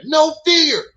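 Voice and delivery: high, loud mock battle cry; loud, high-pitched mimicked holler; mock scream. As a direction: yell it out, imitating his scream. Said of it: "and starts screaming".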